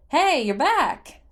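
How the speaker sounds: loud, slow, delighted hello; happy greeting. A narrator's reading. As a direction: greet an old friend with joy. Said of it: "Beetle said happily".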